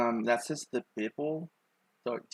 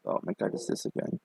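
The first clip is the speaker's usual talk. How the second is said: whispered aside; 4 semitones lower, 8 dB quieter than usual. Retold or imitated. Exasperated inner thought, retold.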